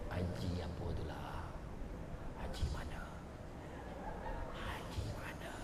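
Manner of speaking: soft unvoiced gossip whisper. Women whisper gossip about the old Haji. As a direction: whisper it behind a hand. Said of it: "kalau dia bisik tu".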